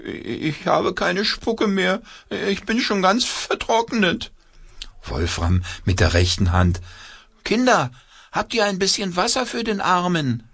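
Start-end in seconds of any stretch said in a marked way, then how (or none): 0.0-4.3 high, slow whimpering puppet; whiny puppet. A narrator's reading. Puppet complains, dried out. 7.4-10.4 very high pleading puppet voice. A narrator's reading. Puppet asks children.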